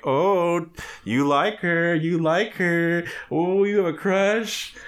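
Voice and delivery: high, drawn-out mock taunt; slow, high-pitched teasing; playground teasing. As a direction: imitate a friend needling about a crush. Imitates Val teasing him about crush.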